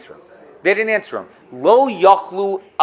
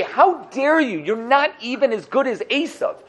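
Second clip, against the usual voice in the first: shocked rebuke; 6 semitones higher than usual. Acted. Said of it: "shock them and say".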